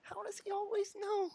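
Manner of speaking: very high, soft squeaky impression; mock cartoon daughter in a thin falsetto; Meg. Streamer copying friends' ugly impression, high falsetto. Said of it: "impression of Meg for the first episode".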